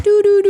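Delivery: high, loud sung doo doo doo; mock patting sounds. The officer patting her down, high loud humming. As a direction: hum a quick pat-down rhythm. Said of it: "you know, patting".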